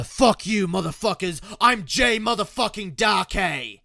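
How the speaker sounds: loud, high-energy swaggering persona shout; Jay Darkay. Shown as a demonstration. Streamer reviving their old persona, brash loud shout. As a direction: yell it as a brash stage persona. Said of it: "get back to this, you know, the".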